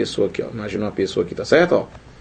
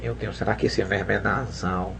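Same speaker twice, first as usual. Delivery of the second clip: low, soft, pinched-nose muttering; nose held, quiet wondering; 4 semitones lower, 9 dB quieter than usual. Pretend. Student secretly testing a verb in the exam, nose held. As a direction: wonder softly to yourself while holding your nose. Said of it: "não vai ficar fazendo assim".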